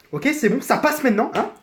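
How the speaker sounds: ordinary talk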